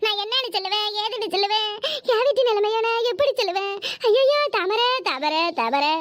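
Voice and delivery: theatrical village dirge; wailing lament. Acted. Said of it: "இப்படி கடந்து ஒப்பாரி வச்சுக்கிட்டு".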